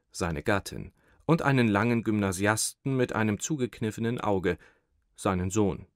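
ordinary delivery, nothing marked

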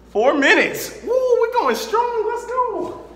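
loud high hype; pumped up shout. Real, not acted. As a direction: cheer yourself on. Cheering his progress.

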